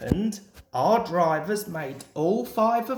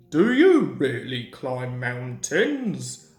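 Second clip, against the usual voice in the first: Skarloey asking; 5 semitones lower than usual. A narrator's reading. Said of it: "asked Skarloey".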